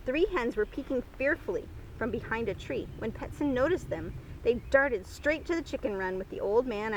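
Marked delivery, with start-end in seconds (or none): none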